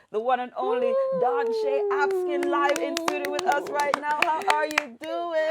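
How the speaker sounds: big, high, loud announcer build-up; hype announcer intro. Real, not acted. Host introducing the star guest on air, hyped and loud. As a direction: sell the guest's entrance like a game show host.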